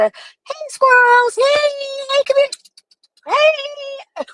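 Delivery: high, slow sing-song mimicry of her calling squirrels; mock squirrel call. Mocking the streamer who switches from rage to calling squirrels, high and slow. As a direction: coo it up high like calling a pet. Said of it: "she goes from fucking irate ... to".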